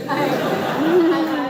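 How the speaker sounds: real laughter breaking into the words; high, loud laughing start. Real, not acted. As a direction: stammer through a loud laugh. Laughs at her own anecdote.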